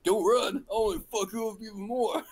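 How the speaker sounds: loud, high mock yell; imitated drill instructor threat. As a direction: roar it after fleeing recruits. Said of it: "And he goes".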